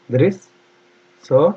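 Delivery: very slow दृष्टा, dri... sh... taa; long gaps between its sounds; syllable-by-syllable dictation. Shown as a demonstration. Teacher dictating spelling slowly.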